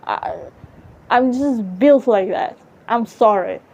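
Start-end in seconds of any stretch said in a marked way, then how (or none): none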